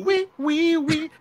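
high, loud siren wee sounds; siren imitation.

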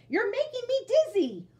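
high, loud complaint; shouting. Acted. Bird shouting at spinning Jack. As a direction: yell that he's dizzy. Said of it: "shouted Bird".

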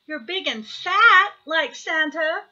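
put-on excited young hare, voice lifted; crying out. A narrator's reading. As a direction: call it out like an insistent child. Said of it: "cried Baby Hare".

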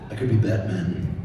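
Batman. Shown as a demonstration. Offers himself as Batman impression.

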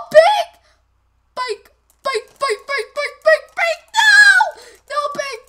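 high, loud frantic yelling; frantic shouting. Real, not acted. Gamer screaming at teammate Pig, frantic and loud. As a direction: call out desperately again and again.

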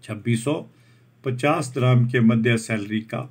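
ordinary talk

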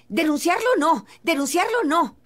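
loud, high-pitched repeated no, imitating her; a retold, pressing denial; emphatic refusal. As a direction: hammer home the refusal as she did. Linda answers at once, retold.

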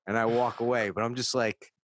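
ordinary delivery

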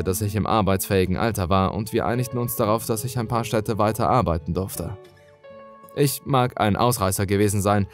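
plain reading voice

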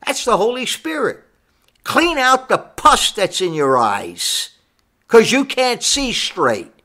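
high-pitched, insistent pulpit delivery; fervent preaching. Real, not acted. Preacher warns of lost spiritual eyesight, fervent preaching.

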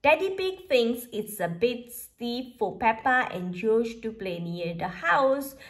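usual speaking voice

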